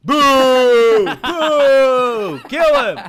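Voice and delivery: high, drawn-out shouted boos; mock crowd heckling. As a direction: jeer loudly like an angry courtroom mob.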